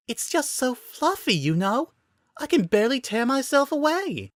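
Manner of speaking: put-on high, gushing girlish voice for Sakura. A narrator's reading. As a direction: gush over the fluffy tail like a smitten girl. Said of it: "Sakura gushed".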